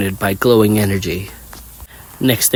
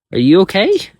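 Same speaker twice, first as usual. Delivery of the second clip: put-on girl voice; 10 semitones higher, 5 dB louder than usual. A narrator's reading. Kari voiced.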